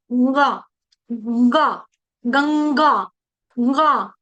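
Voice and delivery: "गंगा" drawn out with a long nasal ng; nasal ṅa demonstration. Shown as a demonstration. Teacher showing where the tongue touches, drawn-out nasal "गंगा". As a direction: hold the ng of "गंगा" at the palate. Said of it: "उधर जाके जीभ छूती है".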